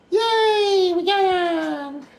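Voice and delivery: very high, drawn-out squeal of triumph; tiny high-pitched hooray, stretched out; mock-celebratory cheer. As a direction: cheer it in a high, sustained falsetto. Celebrates getting the decals on.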